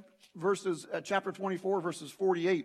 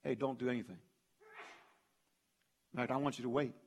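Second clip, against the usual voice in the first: low, soft, measured hold-back; quiet instruction; 3 semitones lower, 7 dB quieter, faster than usual. Acted. Jesus telling his witnesses to wait, quiet and restrained. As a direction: rein them in quietly, leaving pauses. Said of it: "And here's what Jesus says".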